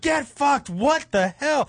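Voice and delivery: high, hammed-up disbelief; retold freak-out. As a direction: pitch it up in mock outrage. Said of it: "what really freaked me out".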